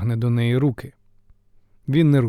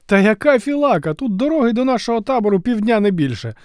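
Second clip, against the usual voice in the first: higher, eager rapid chatter; rattling on excitedly; 9 semitones higher, 4 dB louder than usual. Acted. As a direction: rattle off the spoils. Messenger protesting to Iolaus.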